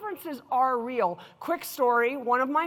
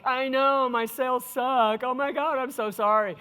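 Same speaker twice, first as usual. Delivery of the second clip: whining; 4 dB louder than usual. Retold or imitated. Mimicking an employee apologizing for bad sales, mock whining. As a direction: imitate a whiny, self-pitying apology. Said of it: "you mean whining".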